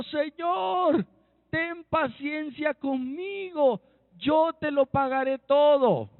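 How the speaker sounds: high, drawn-out begging cry; pleading. Acted. Servant begs his lord for patience over a debt, pleading. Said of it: "Postrado le suplicaba diciendo".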